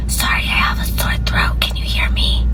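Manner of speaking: faked raspy whisper, barely voiced; mock sore-throat whisper. Caller faking a sore throat to the cashier, whispered. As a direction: whisper like you can't use your voice.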